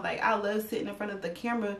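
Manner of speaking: usual speaking voice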